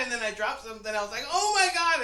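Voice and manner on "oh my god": high, loud panicked exclamation; mock shriek of dismay. Another clatter in the vet.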